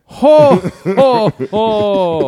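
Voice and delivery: high, loud mock-Santa ho-ho-ho; Minnesota Santa. Podcaster heckling the Christmas pun, booming Minnesota Santa. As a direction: belt out a Santa laugh with Minnesota vowels. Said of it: "what are you, Minnesota Santa".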